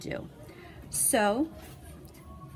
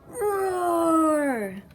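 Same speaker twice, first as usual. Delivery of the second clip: loud high roar; monster roar; 9 semitones higher, 6 dB louder than usual. Acted. Terrible monster roaring at the kid.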